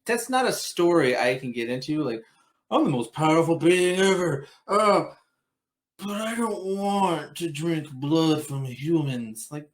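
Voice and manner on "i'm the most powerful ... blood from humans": mock whiny vampire, drawn out. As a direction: whine it like a self-pitying immortal, slowing down. Mocks a whiny vampire character.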